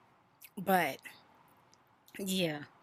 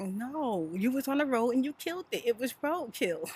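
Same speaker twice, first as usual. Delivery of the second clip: correcting daughter; 7 semitones higher than usual. Retold or imitated. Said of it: "She was like".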